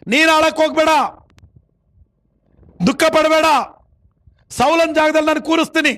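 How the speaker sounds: sustained high sermon cry, endings stretched; forceful preaching. Acted. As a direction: exhort the congregation point by point. Preaches God's instruction to Samuel. (ಏರುದನಿಯ ಪ್ರವಚನ)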